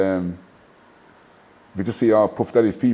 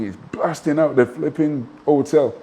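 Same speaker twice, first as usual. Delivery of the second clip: normal talking voice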